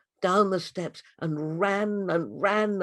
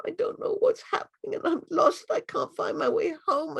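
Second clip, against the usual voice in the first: much higher, louder and anxious; a lost child near tears; a lost girl telling the butterfly her fear; frightened girl; 12 semitones higher, 4 dB louder than usual. Acted.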